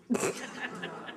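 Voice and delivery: a quiet, airy chuckle; light unvoiced giggle; soft breathy laugh. Real, not acted. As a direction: laugh softly at your own joke. Laughing at her own joke.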